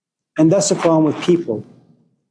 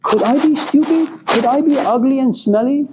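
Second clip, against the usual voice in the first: high-pitched, wondering chicken voice; high, rising self-questioning; self-doubting chicken; 8 semitones higher than usual. Acted. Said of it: "he goes to the bottom of the pot and says".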